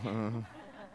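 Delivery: short soft chuckle; quiet laugh. Real, not acted. Minister laughing with the girl, short soft chuckle. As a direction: let out a quick quiet laugh. Minister jokes about wearing jeans.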